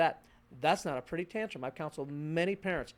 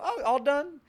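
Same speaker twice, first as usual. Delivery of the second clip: put-on baby cooing; 8 semitones higher, 8 dB louder than usual.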